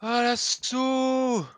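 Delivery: high, loud, slow war cry; battle cry. Pretend. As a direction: call the charge like a warlord.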